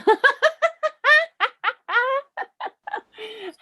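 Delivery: high squealing laugh at a puppy; delighted laugh. Real, not acted. Puppy appears on screen.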